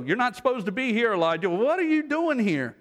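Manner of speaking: high, pressing question to someone out of place; pointed disbelief. Acted. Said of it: "As though".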